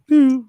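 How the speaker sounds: high, loud "pew" phone noise; sudden high-pitched electronic zap; imitated phone blip. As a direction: mimic a device powering on with a high pew. Imitating the phone assistant switching on.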